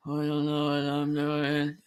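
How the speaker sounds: slow, creaky vocal fry drawl; vocal fry demo. Shown as a demonstration. Showing a friend what vocal fry sounds like, slow and creaky. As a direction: let the voice sink and creak as you drag the words out. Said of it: "that's when you go like".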